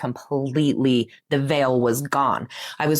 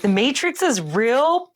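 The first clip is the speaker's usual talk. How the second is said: much higher, slow amazed question; laughing disbelief; 9 semitones higher, slower than usual. Retold or imitated. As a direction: gasp it out in delighted disbelief. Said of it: "I started laughing and I was like".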